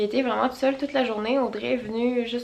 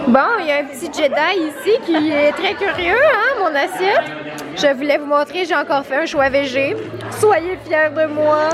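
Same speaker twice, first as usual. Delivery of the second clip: costume character voice; 4 semitones higher, 8 dB louder than usual. Acted. Personnage costumé, voix forte.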